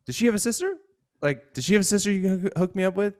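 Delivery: very high-pitched mock eager friend; eager friend asking. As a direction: ask it high and hopeful, angling for a setup. Comic quotes eager, sneaky friend.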